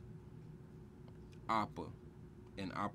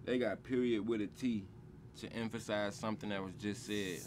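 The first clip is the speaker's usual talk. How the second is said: normal talking voice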